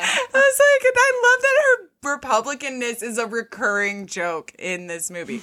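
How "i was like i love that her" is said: laughing. Real, not acted. Laugh-cry while retelling.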